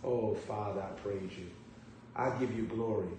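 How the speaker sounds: soft low prayer; hushed prayer. Real, not acted. Preacher stopping to praise God softly.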